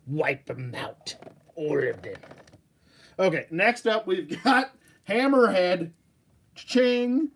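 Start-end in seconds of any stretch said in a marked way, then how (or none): 0.1-2.2 lightly voiced sinister villain; menacing villain quote. Quoting Vader/Palpatine lines. 6.5-7.3 excited cha-ching. Pretend. Excited sound effect.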